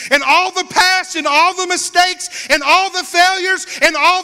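loud, high proclaiming Jesus' promise; preaching Jesus' words. Acted. Preacher proclaiming Jesus' promise to the fallen, loud and high. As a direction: declare it at full force from the pulpit. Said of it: "what Jesus was saying".

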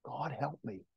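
very soft, hushed prayer; a small private plea to God; inward cry for help. Acted. Saved heart crying out to God, hushed plea. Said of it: "your heart cries out first".

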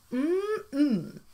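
mock satisfied yum; frog yum. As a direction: savour the mouthful. Frog enjoys meal.

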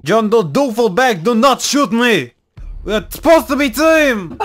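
high, loud put-on accent pleading; mock foreign accent. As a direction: beg a teammate not to shoot, hammed up. Panicked plea in game.

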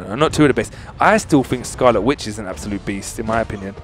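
usual speaking voice

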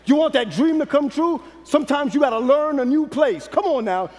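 ordinary talk, nothing marked

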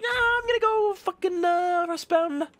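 very high, loud sneering mock voice; hammed-up taunt in a mocking voice; mocking impression. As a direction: mimic the smug opponent again. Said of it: "This one, it's like".

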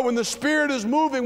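ordinary delivery

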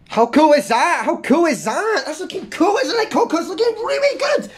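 excited. Real, not acted. Excited praise of drawing.

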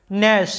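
ন্যাশ starting straight on the N, no G sound; silent G. Shown as a demonstration. Teacher showing learners the silent letter, ন্যাশ with no G sound. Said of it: "জিটার কোনো উচ্চারণ নাই".

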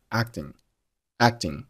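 "acting" with an unreleased k; swallowed c in "acting". Shown as a demonstration. Teacher demonstrating "acting" with the c swallowed. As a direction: hold back the k sound in "acting". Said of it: "但是把声音给吞掉".

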